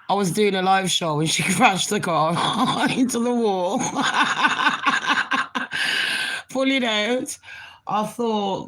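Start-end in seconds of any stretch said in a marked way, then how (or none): 3.8-6.4 long breathy unvoiced laughter; real laughter about the neighbour crashing her car. Real, not acted. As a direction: laugh helplessly in breathy bursts.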